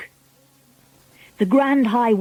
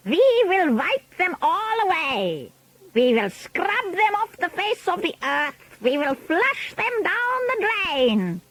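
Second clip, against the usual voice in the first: Grand High Witch; 10 semitones higher, 3 dB quieter than usual. A narrator's reading. Witch leader rallying her followers against children, high imperious voice. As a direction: build the menace with long, high vowels. Witch rallies audience, they echo.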